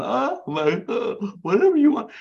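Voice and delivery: high, dazed, besotted babble; gobstruck men. Acted. Smitten soldiers give in to the beautiful woman, gobstruck. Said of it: "these men are just gobstoppers".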